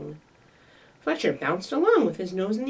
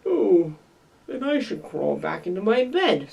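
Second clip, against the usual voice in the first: sadly; 4 dB louder than usual. Acted. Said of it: "snuffled Porcupine sadly".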